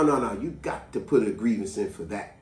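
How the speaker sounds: ordinary delivery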